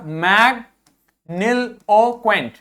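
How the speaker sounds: मैग्निलोक्वेंट said slowly; slow model pronunciation. Shown as a demonstration. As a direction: carefully sound out मैग्निलोक्वेंट. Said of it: "इसी प्रकार". (धीमा उच्चारण)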